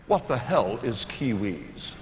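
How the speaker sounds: low, soft, slow mocking English accent; posh English accent. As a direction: imitate a disdainful Englishman. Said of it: "very not pleased people came up and said".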